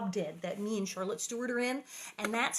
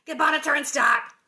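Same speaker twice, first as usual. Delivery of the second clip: calling out wares; 5 semitones higher, 12 dB louder than usual. Pretend. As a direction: holler it like a street vendor. Repeats the merchandise call.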